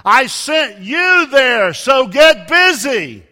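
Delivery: slow, booming shout from heaven; voice of God. Acted. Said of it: "And God answers".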